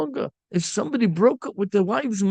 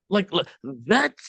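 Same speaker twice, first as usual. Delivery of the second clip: normal talking voice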